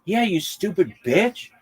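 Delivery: high mock tirade at a heckler; William Montgomery going off. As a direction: rant at the heckler like an enraged comic on stage. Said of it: "He just goes off".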